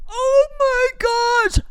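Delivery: high, drawn-out excited squeal. Real, not acted. Telling followers about a surprise, squealing high. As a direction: squeal it like you just remembered. Dramatic build-up to story.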